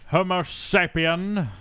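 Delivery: slow, high, grand Gandalf-like voice; drawn out like an old wizard; Ian McKellen impression. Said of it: "Ian McKellen".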